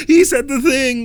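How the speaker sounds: high, drawn-out mock giggling; gushing audience member. Mocking the person in the audience who laughs, high fake glee. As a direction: imitate a moviegoer tickled by a catchphrase. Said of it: "The person in the audience that goes".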